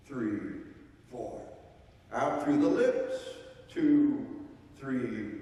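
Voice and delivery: slow, paced breathing instructor; coaching a room through box breathing; guided breathing count. Shown as a demonstration. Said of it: "Eyes open, head back".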